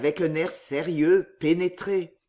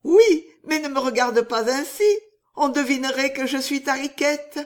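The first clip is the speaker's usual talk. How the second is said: high, louder sweetheart voice; loving Riquette; 6 semitones higher, 5 dB louder than usual. Acted. Riquette teasing Riquet not to give them away, high and fond. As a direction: coo it brightly to a lover. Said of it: "ne parle pas avec cette voix-là".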